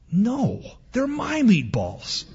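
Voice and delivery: put-on high, slow, stubborn kid refusal; possessive little boy. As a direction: mildly refuse like a boy guarding his plate. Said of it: "And Benny says".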